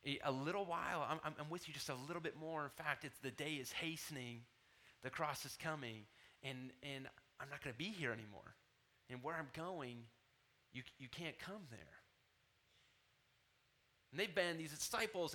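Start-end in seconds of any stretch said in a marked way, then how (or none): 0.1-11.8 soft, lowered, gentle voice; tender forewarning. Acted. Jesus forewarning his disciples he is leaving, tender and compassionate. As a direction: break hard news gently, with halting pauses. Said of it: "Jesus is doing the same thing".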